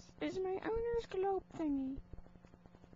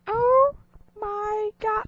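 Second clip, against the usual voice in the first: shocked outburst; 15 dB louder, much slower than usual. Pretend.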